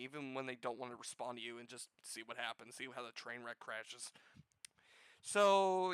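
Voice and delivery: ordinary delivery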